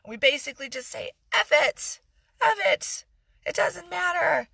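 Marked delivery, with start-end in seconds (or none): 1.3-4.5 higher, louder mock bully shout; playing the bully, shouting it. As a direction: bulldoze over everyone with a shout. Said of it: "the bully mentality".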